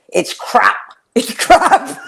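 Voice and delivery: imitated irate Scot, rising into a laugh; irate Scottish. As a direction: do an angry Scot, amused. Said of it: "with a Scottish irate".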